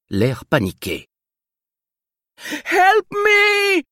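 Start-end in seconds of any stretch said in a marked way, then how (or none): 2.5-3.8 high-pitched panicked cry in broken English; shrieking for help, very high; terrified scream. Acted. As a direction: scream for help in a foreign tongue. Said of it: "hurla-t-elle dans un anglais approximatif".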